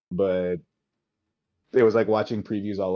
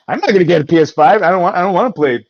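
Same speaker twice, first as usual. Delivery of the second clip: playful rant; 9 semitones higher, 10 dB louder, faster than usual. Real, not acted. Ranting about PS5.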